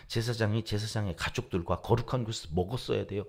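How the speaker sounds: usual speaking voice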